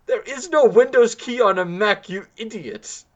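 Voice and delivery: high and loud, then dropping to an insult; exasperated. Retold or imitated. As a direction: lose patience with him. Retelling his exasperated reply.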